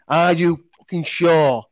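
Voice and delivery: slow, drawn-out pointed question; mock-stern second banner asking if you're sure; mock stern warning. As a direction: ask it slowly like a final warning.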